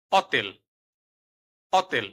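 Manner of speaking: অতেল with a silent h, no হ sound; Italian pronunciation. Shown as a demonstration. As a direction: drop the h: অতেল, not হোটেল. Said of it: "উদাহরণ".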